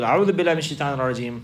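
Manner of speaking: rapid, offhand "A'udhu billahi minash shaitanir rajeem"; mock rushed rote recitation; imitating insincere reciters. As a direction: gabble the prayer like a mere formula. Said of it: "Like some people".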